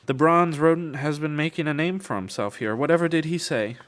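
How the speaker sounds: high, loud, mocking society lady; haughty lady. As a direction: sing it out like a scheming noblewoman. Same noblewoman taunting Darrow.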